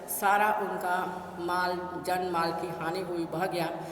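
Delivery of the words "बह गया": ordinary delivery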